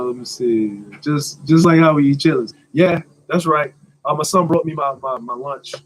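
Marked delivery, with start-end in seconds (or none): none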